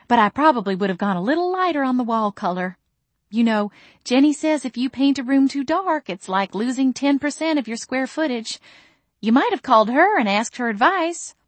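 high, sugary mama voice; sweetly critical mother. A narrator's reading. Mother gently criticising her daughter's wall color, high sweet voice.

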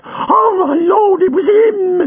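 high, loud, near-crying panic; a terrified girl crying to get away; panicked terror. Acted. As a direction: sob and cry out to escape. Girl terrified by Chang's poster.

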